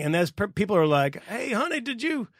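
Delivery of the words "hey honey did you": high mocking voice of a spouse at home; mock chatty spouse. Mocking ordinary Americans, a high chirpy spouse voice. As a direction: play a chirpy husband asking his wife, hammed up.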